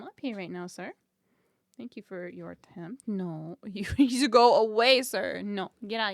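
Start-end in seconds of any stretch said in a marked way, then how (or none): 3.6-6.1 loud shooing, laughing; yelling across the room. Real, not acted. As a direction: shout the cat away, laughing. Shooing the cat away.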